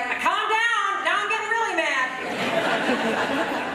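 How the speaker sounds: high, loud outburst of someone upset; mock angry yell. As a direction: yell it back, imitating someone losing their temper. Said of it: "Have you ever been upset".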